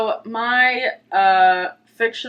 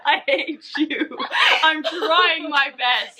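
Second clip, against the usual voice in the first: laughing through the words, higher, slowed on the second line; a real laugh cracking the playful complaint; laughing protest; 6 semitones higher, slower than usual. Real, not acted. Teasing friend, breaks into laughter.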